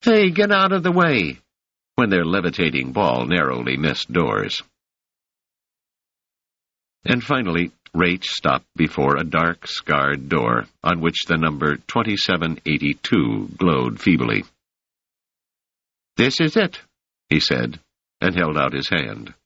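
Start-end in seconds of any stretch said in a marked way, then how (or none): 0.0-1.4 put-on high shouted kid's call; kids yelling. A narrator's reading. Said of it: "Some of them yelled". 16.2-16.8 put-on high, cocky street kid; brash young urchin, pitched up; raised boy's voice, offhand; street boy Rache. A narrator's reading. Rache speaks.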